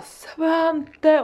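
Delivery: calling out. Retold or imitated. Mum calling her son on the bridge, mock calling out. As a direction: imitate calling a child from afar. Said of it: "Och så ropar jag deras namn".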